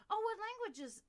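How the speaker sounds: high, loud curious question; imitated keen interest. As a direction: mimic a woman suddenly intrigued. Retells the lady's eager question.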